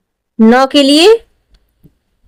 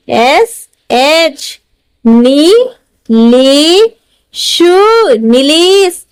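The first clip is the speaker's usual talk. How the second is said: slow dictation; much slower than usual. Shown as a demonstration. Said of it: "teacher dictating letters".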